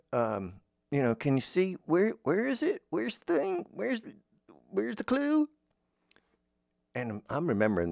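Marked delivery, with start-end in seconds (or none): 1.1-5.5 put-on high, eager kids' TV host asking where the clue is; bright children's presenter, pitched way up; imitating a Blue's Clues host. Host asking kids at home where the clue is, high and eager. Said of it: "he was, you know, asking questions".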